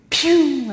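high sharp "pium" like a burst balloon; popping sound effect. Retold or imitated. Preacher showing joy vanishing, popping sound. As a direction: pop it like a bubble bursting.

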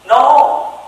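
usual speaking voice

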